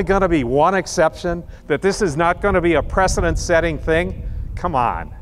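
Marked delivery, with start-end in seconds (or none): none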